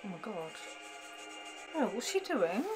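ordinary talk

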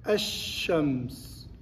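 "الشمس" said ash-shams, long doubled sh; silent lam. Shown as a demonstration. Teacher shows lam is written but unspoken, slow assimilated "الشمس". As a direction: draw out "الشمس" slowly with no l sound. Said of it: "تكتب ولا تنطق".

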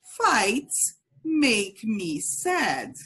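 very slow, clear model for repeating; slow model phrase. Shown as a demonstration. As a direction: drill it slowly, word by word. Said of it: "So repeat".

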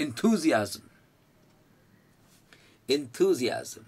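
enthusiasm at normal pace, four clear syllables; model pronunciation. Shown as a demonstration. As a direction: say enthusiasm crisply for the class to repeat. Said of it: "drilling the word again".

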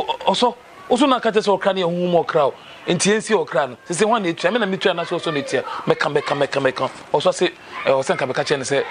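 usual speaking voice

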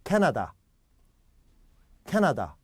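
캐나다 with every vowel clear and full; typical Korean pronunciation. Retold or imitated. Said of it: "십 중 팔구 이렇게 할 거예요".

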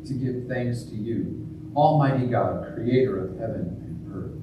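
solemn intoned eucharistic prayer; liturgical prayer. Acted. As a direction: pray it aloud with reverent weight.

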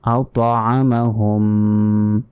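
আতআমাহুম slowly, bouncing "t" and throaty "a"; measured, throat-squeezed ain in আতআমাহুম; careful recitation. Shown as a demonstration. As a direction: hum the final m of আতআমাহুম. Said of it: "বলতে হবে আওত".

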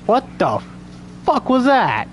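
real startled high-pitched outburst. Real, not acted. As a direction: jump out of character in sudden fright. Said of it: "That piece of shit scared me".

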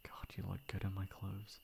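soft unvoiced murmur to yourself; whispered aside. Friend admires guest in borrowed clothes, whispering to self. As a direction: let it slip under your breath.

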